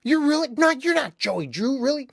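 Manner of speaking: very high, loud imitated disbelief; freaking out fans. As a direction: mimic fans losing it in astonishment.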